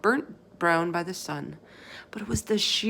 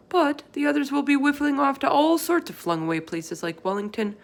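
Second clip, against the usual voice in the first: folksy giant voice, a touch higher; BFG voice; 3 semitones higher, 4 dB louder than usual. Acted. Giant explaining to Sophie what the others do, folksy. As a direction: play the big friendly giant, chatty and earnest. BFG lists hunting grounds.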